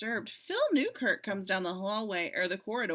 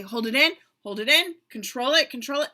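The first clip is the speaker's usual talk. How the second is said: raised, insistent rehearsal calls; coaching over music; 3 semitones higher, 8 dB louder than usual. Acted. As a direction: urge the dancer on loudly. Choreographer coaching during the dance.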